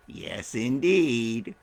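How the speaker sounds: low, very slow and smug; sly admission. Acted. The smiling bird answers the tricked man, low and sly. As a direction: savour the trick with a knowing drawl.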